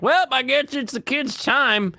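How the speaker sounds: high, singsong put-on voice; mock daycare staff. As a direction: cheerily imitate staff in a squeaky voice. Imitates daycare staff releasing kids.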